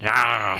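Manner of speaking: imitated growl, drawn out; dog growl. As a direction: snarl like an angry dog. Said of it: "Starts growling".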